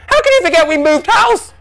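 very high, loud disbelieving shout; dad at the peak of disbelief; increasingly incredulous. A narrator's reading. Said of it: "increasingly incredulous".

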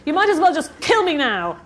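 mother crying out from the bathroom. A narrator's reading. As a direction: wail it from the next room. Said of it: "their mother cried".

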